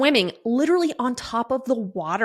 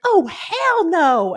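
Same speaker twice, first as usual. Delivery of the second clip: slow, drawn-out refusal; 8 semitones higher, much slower than usual. Retold or imitated. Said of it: "she was like".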